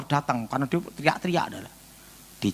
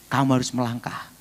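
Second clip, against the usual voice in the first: ordinary delivery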